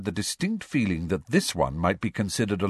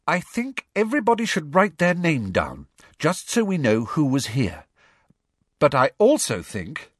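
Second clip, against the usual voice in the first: high, earnest schoolgirl voice; steeling herself; 7 semitones higher, 3 dB louder than usual. A narrator's reading. Schoolgirl asking everybody to sign, high and braced. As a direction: brace yourself and put the request firmly. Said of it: "steeling herself to say something".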